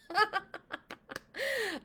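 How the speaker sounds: high, bright burst of laughter at the joke; real burst of laughter. Real, not acted. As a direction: laugh out loud, delighted. Laughs at the name Harold.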